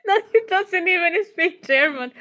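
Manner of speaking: laughing hard, high and loud; crying with laughter. Real, not acted. Laughing after flaming the boss.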